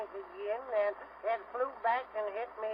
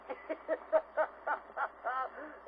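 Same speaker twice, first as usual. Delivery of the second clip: string of short staccato chuckles; a storyteller's punctuating laugh; comic chuckle; 3 semitones higher than usual. Acted. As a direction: chortle at your own joke. Laughs at his own disaster.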